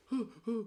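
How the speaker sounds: high, mock tearful huffs; imitated sobbing gasps. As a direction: huff like a friend about to cry. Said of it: "he goes like this".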